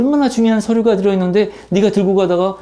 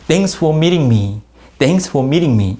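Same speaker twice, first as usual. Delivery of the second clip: slow model reading; much slower than usual. Shown as a demonstration. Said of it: "teacher models the sentence".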